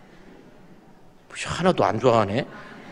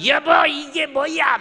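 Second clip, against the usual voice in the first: high, slow, shouted scolding; angry snap; 13 semitones higher, slower than usual. Retold or imitated. Faithless husband yelling at his wife, angry shout. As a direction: yell at the wife in anger. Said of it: "막 이렇게 나와요".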